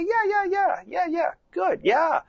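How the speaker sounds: very high, light, rapid-fire yeahs; high-pitched cooing encouragement; bright praise tone. Shown as a demonstration. Rider cheering horse's toe in water, bright tone. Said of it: "just my tone of voice".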